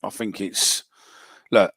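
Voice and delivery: ordinary delivery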